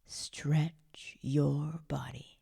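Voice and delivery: very slow, elongated STRETCH YOUR BODY; drawn-out stretch. Acted. Meditation guide tells listener to stretch the body, slowly drawn out. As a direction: pull the words out like the stretch itself.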